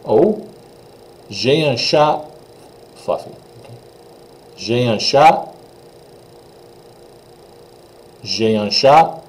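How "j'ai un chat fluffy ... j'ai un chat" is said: J'ai un chat with the T of chat silent; teacher demo; French pronunciation. Shown as a demonstration. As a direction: model J'ai un chat, no final T. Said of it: "Notice that the T is totally silent".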